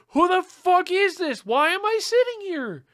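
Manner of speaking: put-on high, loud, horrified kid protest; panicked outburst. Reliving being bawling on Santa's lap, panicked yelling. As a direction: cry out in alarmed disbelief.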